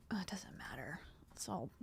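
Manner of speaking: whispered, dismissive aside. Real, not acted. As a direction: whisper it off under breath.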